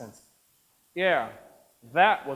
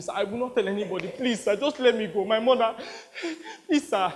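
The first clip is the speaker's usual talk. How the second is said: breathless, rushed begging; frightened pleading boy; 4 semitones higher than usual. Acted. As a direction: beg for your life as a terrified child. Captive boy begs warlord.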